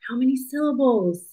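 ordinary delivery

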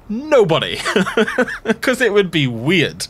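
high, loud burst breaking into laughter; laughing incredulity. Real, not acted. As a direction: laugh through your disbelief. Laughing as the rant peaks.

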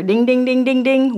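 rapid chanted bell dings; imitated ring bell. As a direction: sound the bell for a round.

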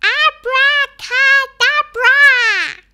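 loud, high, slow incantation; ringing drawn-out spell cry; shouted spell. A narrator's reading. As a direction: shout the magic word, stretching each syllable. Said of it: "and shouted".

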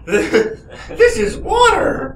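laughing yell, very high and slow; laughing shout. Real, not acted. Laughing shouted outburst at whisky.

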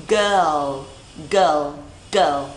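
put-on low "Girl" drawn out onto final l; correct pronunciation. Shown as a demonstration. Teacher drills learners, low "Girl" with clear final l. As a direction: say "Girl" slowly, ending on a dark l.

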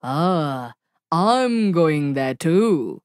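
low, slow, sly wolf voice. Acted. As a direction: drag the words out like a scheming wolf. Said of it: "said the wolf".

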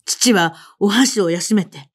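plain reading voice

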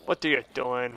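low, soft questioning; muted disbelief. Real, not acted. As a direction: ask it under your breath. First asking, then louder repeat.

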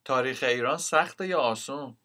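ordinary talk

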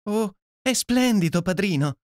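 put-on young goddaughter cries out in delight; delighted cry. A narrator's reading. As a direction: squeal it as if about to dance. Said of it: "gridò Ruby, quasi mettendosi a ballare".

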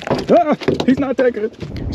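high, slow mock-humble voice; mock modest. Angler answering his own praise, high mock-modest voice. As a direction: answer yourself in a silly high voice.

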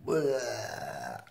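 quiet retching bleh; a small being-sick noise. Acted.

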